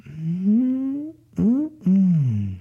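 high hum sliding downward; gloating yum. Acted. Brother eats cake in front of him, gloating drawn-out hum. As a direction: savor it tauntingly.

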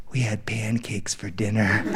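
put-on soft drowsy child voice; sleepy. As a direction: murmur it half asleep. Sleepy son at bedtime.

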